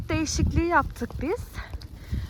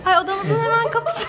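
laughing; 8 semitones higher, 6 dB louder than usual. Real, not acted. Reaching the door laughing.

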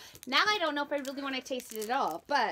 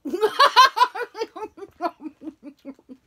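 loud laugh; 10 semitones higher, 17 dB louder than usual. Real, not acted. Reacting to a nasty snack.

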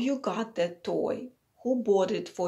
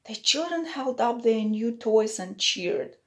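ordinary delivery, nothing marked

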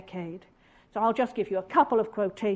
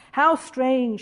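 ordinary talk

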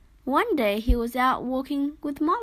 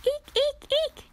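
squeaked very high; piglet squeals. A narrator's reading. Piglets running through the wheat, high squealing.

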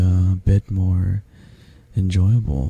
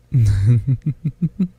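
rippling, mischievous giggle; long teasing chuckle; playful chuckle; 3 semitones higher than usual. Acted. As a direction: laugh as if you've caught them. Playfully trapping guest.

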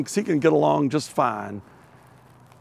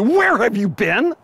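higher, louder scolding demand; wife scolding her late husband; 8 semitones higher, 4 dB louder than usual. Shown as a demonstration. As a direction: scold him like a wife who waited up. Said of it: "much closer to".

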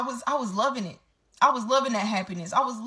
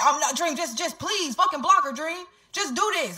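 higher-pitched imitation of begging; urgent retold plea, pitched up; retold frantic pleading; 7 semitones higher than usual. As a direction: beg insistently, as if quoting him. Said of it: "he was flipping out".

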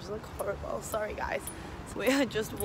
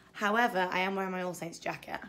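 ordinary talk, nothing marked

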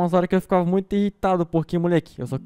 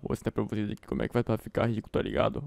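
put-on old man voice; 5 semitones lower, 5 dB quieter than usual. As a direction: talk like a creaky old man. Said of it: "vou ter que fazer uma voz de velho".

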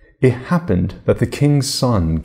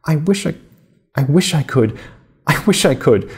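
mild tearful halting, breaking off; sobbing; 7 semitones higher than usual. Acted. Cinderella telling her godmother her wish, mildly tearful and broken off. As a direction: catch on each try, a touch of sob. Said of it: "could not finish from sobbing".

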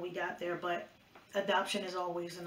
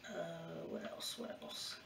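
whispering; 2 semitones lower, 11 dB quieter, slower than usual. Real, not acted. Thinking aloud to herself.

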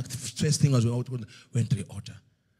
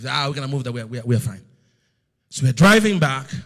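ordinary talk, nothing marked

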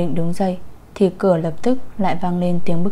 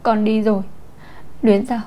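higher, tearful sobbing voice of a grieving mother; 3 semitones higher, faster than usual. A narrator's reading. Mother sobbing to Luyến Giao over her dead child, tearful. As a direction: play her fake grief, teary and pleading. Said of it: "rưng rưng nức nở nói".

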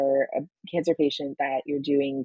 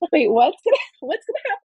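panicky; 11 semitones higher, 4 dB louder, faster than usual. Retold or imitated. Panicked retelling of side effects.

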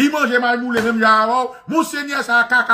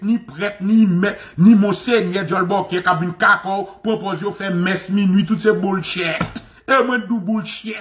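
ordinary talk

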